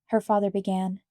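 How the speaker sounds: usual speaking voice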